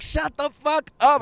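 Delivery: loud, high, drawn-out amazement; acted disbelief. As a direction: re-enact being stunned by a coincidence. Retells his disbelief at coincidence.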